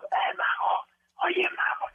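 voiceless, barely there voice; hoarse whisper. Retold or imitated. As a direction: rasp it out with no voice. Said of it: "llegó Javier López mudo".

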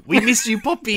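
high, sweet mock-sentimental call; teasing voice crying out to Poppy; mock tender. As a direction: coo it to a long-lost grandpa.

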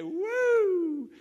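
high, loud cheer of joy; a loud, high whoop; joyful whoop. Retold or imitated. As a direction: whoop like thirsty people hearing good news. People cheer at water news.

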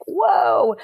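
high, stunned whoa; mock gasp of disbelief; amazed. Reacting to her mom's trust, a mock amazed whoa. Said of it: "And I'm like".

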